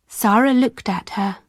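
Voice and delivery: plain reading voice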